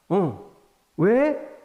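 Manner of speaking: a wife caught off guard, jumping at the news; startled wife. Retold or imitated. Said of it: "아내가 깜짝 놀란 거죠".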